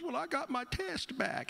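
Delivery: very high imitated mother's voice; upset mom. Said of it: "I could tell she's upset".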